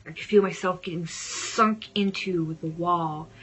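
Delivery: ordinary delivery, nothing marked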